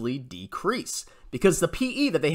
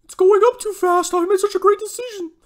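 shrieky high, loud parody voice; mocking echo; 7 dB louder than usual. Critic mimics the investor's clip, loud high mocking voice. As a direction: throw the boast back in a silly high voice.

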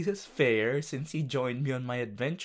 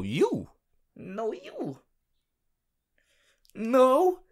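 playful no you; 8 semitones higher, 6 dB louder, much slower than usual. Pretend. Playful no-you back-and-forth.

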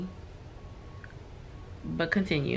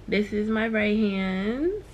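ordinary talk, nothing marked

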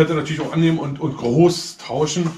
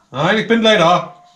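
louder, slower insistent call; from another room; 4 semitones higher, 6 dB louder, slower than usual. Real, not acted. Repeats call, more insistent.

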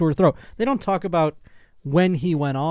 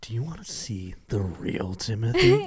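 low, softer, put-on threatening voice; mock menace; 5 semitones lower, 6 dB quieter than usual. Imitating enraged Timothy warning his partner, low mock menace. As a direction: drop it low and quiet, a pretend threat. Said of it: "telling his partner".

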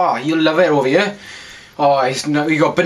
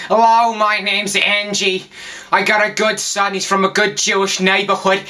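New York accent; 3 semitones higher than usual. Shown as a demonstration. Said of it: "accent you get in around New York and New Jersey".

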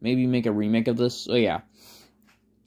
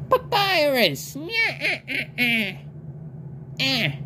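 Papyrus voice; 12 semitones higher than usual. Acted. Voicing Papyrus with his laugh.

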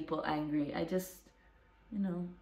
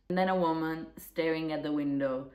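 usual speaking voice

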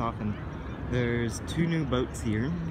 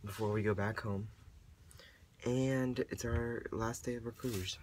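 raspy whisper; 3 semitones lower, 11 dB quieter than usual. Real, not acted. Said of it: "Excuse my voice".

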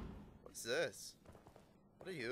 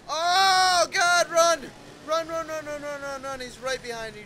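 frantic shouting while fleeing; yelling while running away; 6 semitones higher, 9 dB louder than usual. Real, not acted. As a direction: scream run over and over. Chased by the monster, yelling.

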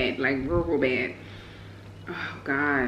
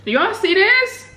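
high delighted squeal; amazed; 13 semitones higher, 3 dB louder than usual. Real, not acted. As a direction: show off the food in wonder. Amazed at the chicken.

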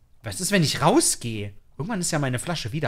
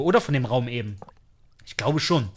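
ordinary talk, nothing marked

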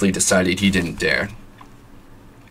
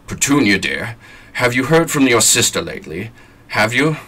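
uneasy, higher and louder; nervous hesitant; 4 semitones higher, 3 dB louder than usual. Acted. Husband nervously asking wife about her sister, hesitant. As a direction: tiptoe into the question nervously.

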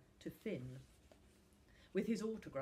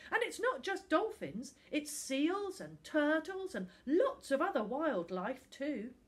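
put-on pleading boy; 9 semitones higher, 8 dB louder than usual. A narrator's reading. Finn pleading.

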